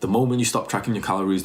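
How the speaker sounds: usual speaking voice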